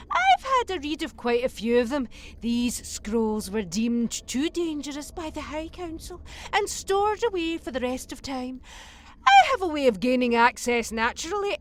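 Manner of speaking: ordinary delivery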